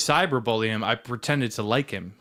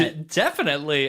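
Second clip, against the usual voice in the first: mocking inflection; 4 semitones higher than usual. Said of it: "with such a inflection".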